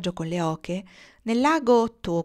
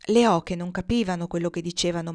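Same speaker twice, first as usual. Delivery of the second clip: ordinary delivery, nothing marked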